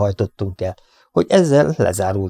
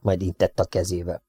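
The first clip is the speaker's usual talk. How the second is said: ordinary delivery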